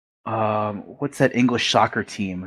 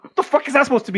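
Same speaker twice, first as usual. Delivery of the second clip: much higher, baffled disbelief; incredulous; faster than usual. Retold or imitated. Teller reacting to his friend's fake British phrase, incredulous and much higher. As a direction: squeak out the disbelief.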